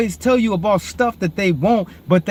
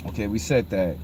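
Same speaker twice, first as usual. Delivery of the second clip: ordinary talk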